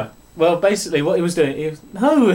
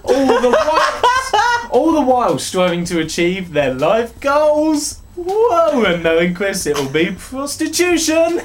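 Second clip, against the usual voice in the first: laughing through a mock toast, pitch jumping high; barely holding it together mid-toast; best man speech through laughter; 6 semitones higher than usual. Reading Sims intro as best man, cracking up laughing. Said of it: "in the style of a best man speech".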